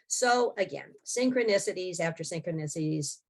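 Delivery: normal talking voice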